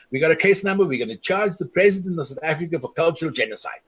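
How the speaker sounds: normal talking voice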